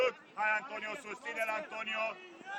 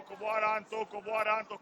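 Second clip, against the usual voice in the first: ordinary talk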